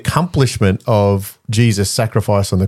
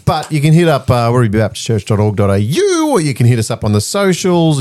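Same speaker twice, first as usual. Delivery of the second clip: ordinary talk, nothing marked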